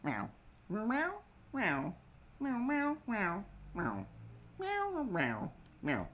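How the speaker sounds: high, squeaky meowing; cat meows. Pretend. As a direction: meow like a little kitten.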